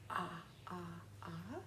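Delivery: breathy ah, ah, ah; whispered ah. Shown as a demonstration. Teacher leading kids through sounds, whispered ah. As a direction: whisper the octopus "Ah". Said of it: "do the sound and signal".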